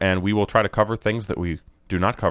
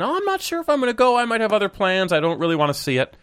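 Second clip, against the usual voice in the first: whiny mock voice; 10 semitones higher than usual.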